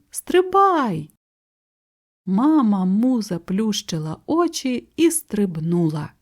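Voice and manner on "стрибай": very high urgent cry. A narrator's reading. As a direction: send the pitch way up to urge the jump. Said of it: "крикнув він".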